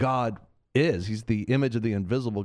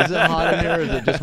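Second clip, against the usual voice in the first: laughing; 7 semitones higher, 7 dB louder, faster than usual. Real, not acted. Joking about his accent.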